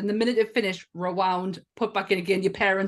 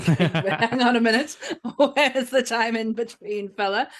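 mock protest through laughter, pitched up; laughing disbelief; 4 semitones higher, 5 dB louder than usual. Viewer heckles the film's impossible journey, laughing disbelief. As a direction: laugh while calling out the nonsense.

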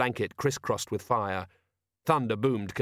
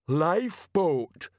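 very slow, drawn-out, higher-pitched "Lifeboat"; slow dawning realisation; 7 semitones higher, 3 dB louder, much slower than usual. A narrator's reading. Detritus facing an unpleasant truth, slowly dawning "Lifeboat". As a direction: stretch out "Lifeboat" as unwelcome truth dawns. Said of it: "sounded like someone exploring an unpleasant truth".

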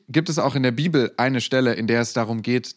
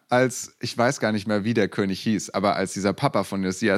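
ordinary delivery

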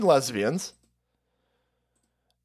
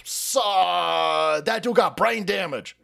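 mock bystander; 2 semitones higher, slower than usual. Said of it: "everyone's like".

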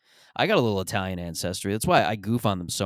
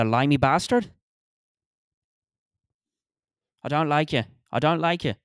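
put-on louder, higher, confrontational Irish accent; tough Irish brawler; 6 semitones higher, 4 dB louder than usual. Irish pub tough to a kid in Gucci slippers, confrontational. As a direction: pick a fight in a put-on Irish voice. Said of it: "starts rolling up his sleeve like".

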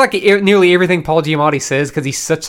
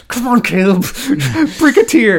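self-urging; 8 semitones higher, 3 dB louder than usual. Retold or imitated. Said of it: "and I was like".

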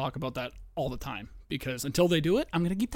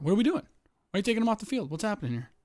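exasperated outcry; 7 semitones higher, faster than usual. Real, not acted. Yelling at the football game.

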